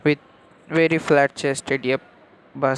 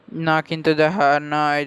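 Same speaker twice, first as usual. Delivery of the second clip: ordinary delivery, nothing marked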